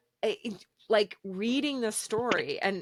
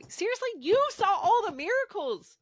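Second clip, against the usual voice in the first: much higher, mock exasperated voice; incredulous disbelief; 5 dB louder than usual. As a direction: pitch it way up in outraged disbelief. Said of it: "you're like".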